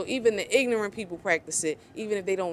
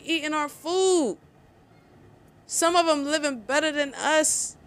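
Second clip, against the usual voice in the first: quoted resentful comment; 5 semitones higher than usual. Retold or imitated. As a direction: lightly voice the resentful local. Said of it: "One comment said".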